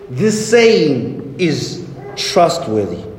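slow, low, weighty declaration; solemn proclamation. Retold or imitated. Preacher quotes Paul addressing the world, solemn and slow.

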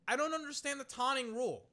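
high-pitched, exasperated protest; indignant disbelief. Real, not acted. Streamer fed up with the taunting rule, indignant.

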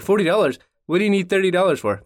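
high, loud mock outrage; outraged dad. A stingy dad yells at his son over money, high and loud. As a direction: blow up at the price like a stingy dad.

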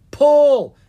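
one high, very loud command; shouting. A narrator's reading. Dragon sister to her struggling brother, urgent shout. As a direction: shout it with all your might. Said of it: "Ella shouted".

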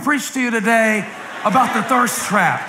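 high, ringing sermon delivery; full-force preacher cadence; preaching. Acted. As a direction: build it up for the congregation.

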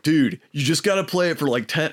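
laid-back surfer dude. Retold or imitated. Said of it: "I'm gonna do the surfer voice too".

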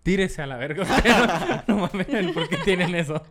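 laughing. Real, not acted. Laughing at the Virgencita merch.

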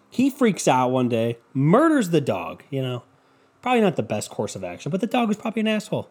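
ordinary talk

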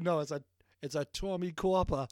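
put-on thick Boston accent, dropped R. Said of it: "in the Boston accent".

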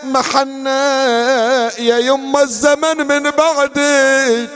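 slow, drawn-out keening with a sob; mournful wail, voice near breaking; wailing lament. Acted. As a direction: cry out to the lost mother. Wailing for the mother.

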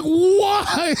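high, wailed disbelief; mock dismay. Reader questions Batman's insult, high mock dismay. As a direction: wail the question in mock despair.

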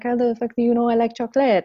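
put-on light French accent, amazed and quick; imitating French accent. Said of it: "she has this beautiful French, French accent".

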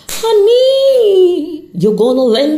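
high, loud, drawn-out honey; sassy honey. Acted. To the panicking listener, a loud, high, sassy honey. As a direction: call them honey with loud sass.

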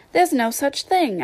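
high-pitched, understated anger; angry. A narrator's reading. Alice objecting to the treacle well, mildly angry. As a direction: protest with a touch of anger. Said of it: "beginning very angrily".

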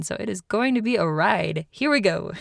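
ordinary talk, nothing marked

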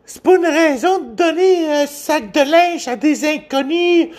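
high squeaky mimic of a nagging woman; mock her in a shrill falsetto; mocking woman's voice. Mocking a woman online in a high squeaky voice. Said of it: "T'en as une autre aussi qui dit genre".

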